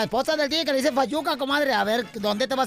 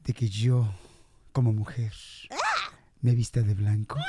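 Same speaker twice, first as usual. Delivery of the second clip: put-on girlfriend voice; 10 semitones lower than usual.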